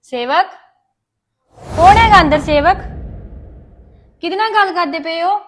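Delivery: loud call from the next room. Acted. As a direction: shout through the door, suspicious. Someone calls out from outside.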